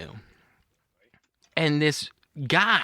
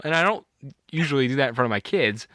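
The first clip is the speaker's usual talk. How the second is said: ordinary delivery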